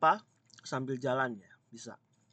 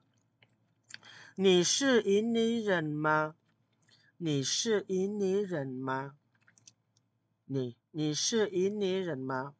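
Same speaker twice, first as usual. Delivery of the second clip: slow, careful Mandarin question; slow example question; 5 semitones higher, much slower than usual. Shown as a demonstration. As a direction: model the question slowly. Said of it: "apakah kamu orang Indonesia". (pertanyaan contoh dibaca pelan)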